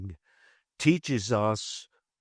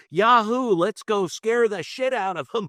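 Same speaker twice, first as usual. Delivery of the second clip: gleeful cheering angels; 10 semitones higher than usual. Acted. Angels eager to test someone seeking courage, gleeful whoop. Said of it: "angels up there that go".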